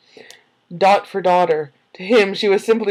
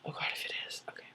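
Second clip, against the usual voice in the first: whispered dread; 25 dB quieter, much faster than usual. Real, not acted.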